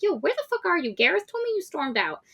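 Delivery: high-pitched frantic friend on the phone; friend Maggie. Retold or imitated. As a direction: squeak it out as the panicked best friend. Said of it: "her friend Maggie called her and was like".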